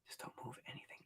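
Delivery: hushed unvoiced whisper. Real, not acted. Quietly warning a viewer not to move anything, whispered. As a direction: breathe it out under your breath.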